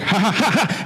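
high, mock demon cackle; showing-off little demon's laugh; put-on demon laugh. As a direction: fake a teasing "ha ha" laugh. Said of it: "He's like".

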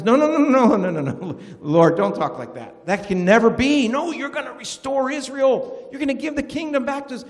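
high, pleading burst of no's; frantic protest. Retold or imitated. Peter protests Jesus' coming death.

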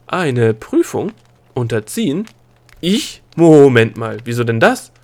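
slow, questioning echo bursting into loud protest; indignant disbelief. Acted. Princess learning she must take a test, startled and protesting. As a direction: echo it incredulously, then loudly call a halt.

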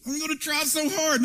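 mock determined promise; mock earnest effort. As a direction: play the eager striver. Mocking self-reliant believer.

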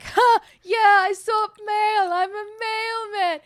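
high, slow drunk impression. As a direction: ham up a drunk's rambling.

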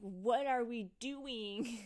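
loud, slow mock exasperation; exasperated. Reacting to coworkers' repeated how-are-yous, exasperated. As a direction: draw it out loudly, as if fed up.

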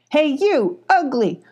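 a hidden bee mocking a bully; calling out. A narrator's reading. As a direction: shout a jeer from hiding. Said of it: "called a voice".

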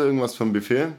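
ordinary talk, nothing marked

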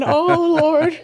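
high, loud, hammed-up dismay; mortified exclamation. Mom watches daughter knock on windows, comic mortified wail. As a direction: wail it in comic mock horror.

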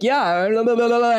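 half-hearted angry blah-blah; mock angry retelling. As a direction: imitate someone getting mad in nonsense syllables. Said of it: "he gets all angry and mad".

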